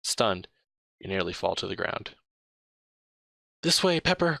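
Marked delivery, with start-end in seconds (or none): 3.6-4.4 higher, louder call to a friend. Acted. Sasha calls to Pepper across the chaos, loudly. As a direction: cry out so she can find you. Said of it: "cries Sasha".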